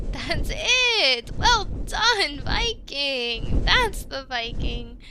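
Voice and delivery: loud, rising cheer with drawn-out words; cheering praise. Acted. Trainer to new Viking rider who took off well, cheering. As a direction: call out proud encouragement to the rider.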